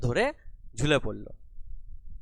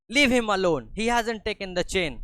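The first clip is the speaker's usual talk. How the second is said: disgusted plea; 7 semitones higher, 5 dB louder, much slower than usual. Acted. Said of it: "felt disgusted ... and said".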